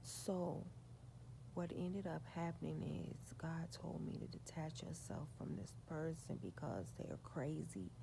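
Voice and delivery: ordinary talk, nothing marked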